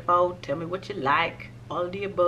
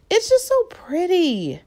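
high, slow, gushing praise; 10 semitones higher, 3 dB louder, slower than usual. Real, not acted. Gushing to viewers about the pretty dress. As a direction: swoon over the dress.

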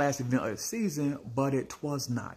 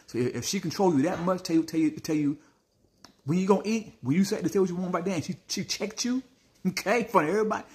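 indignant rant; 6 semitones higher than usual. Real, not acted. Mocking customer's controlled life.

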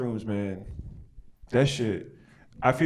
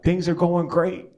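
blissed out; 4 semitones higher than usual. Retold or imitated. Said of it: "But I was on mushrooms".

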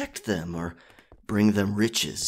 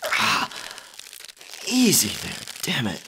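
wincing in pain; 7 semitones higher than usual. Acted. Wounded captain being handled.

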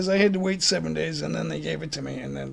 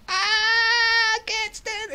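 mock exasperation. Man complaining about silly TikTok sound effects, mock exasperated. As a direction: wail it up high as if driven mad.

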